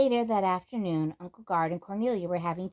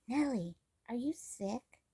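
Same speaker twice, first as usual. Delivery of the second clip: soft, slow worried question; 5 semitones higher, 6 dB quieter than usual. Acted. Samantha gently asks her sick friend, soft and worried. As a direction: ask gently, afraid of the answer.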